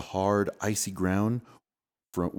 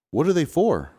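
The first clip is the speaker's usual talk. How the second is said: curious call; 8 semitones higher, 6 dB louder than usual. Acted. Curious boy calling out.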